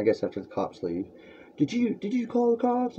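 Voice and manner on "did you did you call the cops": high, soft whiny imitation of Matt; mocking Matt. Matt calling after the cops left, mocked high and soft. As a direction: mimic the caller as a whiny weakling. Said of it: "he calls me".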